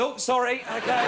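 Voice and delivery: meek apology. Retold or imitated. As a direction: cave in sheepishly.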